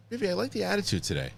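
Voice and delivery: high, fast mock-sweet compliment to his daughter; sarcastic praise. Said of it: "Oh, you're being sarcastic".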